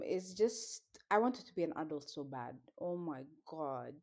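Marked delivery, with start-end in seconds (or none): none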